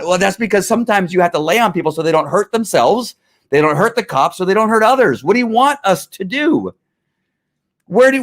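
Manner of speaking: usual speaking voice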